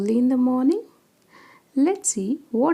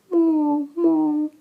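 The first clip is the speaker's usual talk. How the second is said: long, steady, higher-pitched moo; drawn-out cow call, pitched up; cow's moo; 6 semitones higher, 3 dB louder than usual. Acted. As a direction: imitate a cow mooing for young pupils. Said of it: "A cow says".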